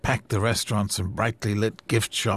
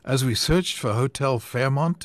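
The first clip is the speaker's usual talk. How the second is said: ordinary delivery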